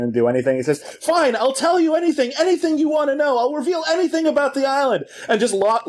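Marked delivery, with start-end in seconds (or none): 0.8-5.0 much higher, fast desperate bargaining; Ben. Retold or imitated. Cornered man offering island secrets, desperate bargaining. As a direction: imitate a man at a loss, offering anything. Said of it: "Ben's kind of at a loss. He's willing to just bargain".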